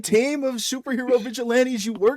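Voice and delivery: mocking exasperation. Real, not acted. Sarcastic comeback to friend.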